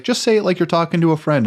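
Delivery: normal talking voice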